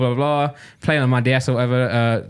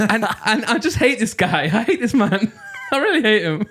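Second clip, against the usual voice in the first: high, slowed rant breaking into laughs; laughing exasperation; 6 semitones higher than usual. Real, not acted. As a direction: declare the hatred through giggles. Comic rant hating the train man.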